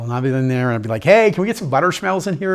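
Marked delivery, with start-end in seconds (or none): 1.0-2.5 higher, louder cheeky call-out; chirpy, retold wisecrack; playful request. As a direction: pipe up to tease the staff. Jokes with radiation staff.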